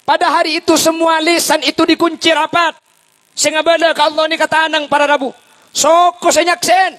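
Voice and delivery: high, booming sermon proclamation; full-force preaching. Acted. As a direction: thunder it out to the congregation, every word high and loud.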